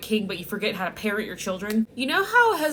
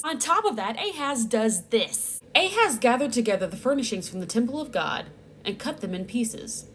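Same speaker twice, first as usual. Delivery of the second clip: ordinary talk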